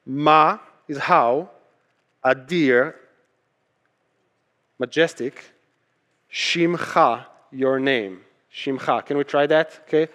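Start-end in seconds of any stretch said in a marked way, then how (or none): none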